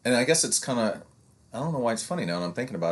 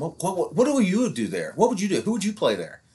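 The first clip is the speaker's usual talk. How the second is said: higher mock parent badgering; pestering parent; 10 semitones higher than usual. Parent quizzing a coach on his calls, higher and badgering. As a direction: pitch it up like an eager second-guesser. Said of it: "I hate that question".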